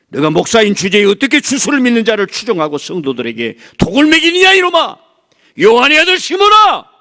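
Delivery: high, loud, furious yell; thundering rebuke. Acted. Jesus confronting false pastors, furious thundering shout. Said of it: "이상한 목사들을 불러다가".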